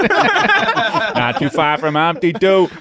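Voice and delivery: slow Boston-Kennedy voice, laughing; a little JFK. Pretend. Co-host slips into a slow laughing JFK impression. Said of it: "That was a little J F K".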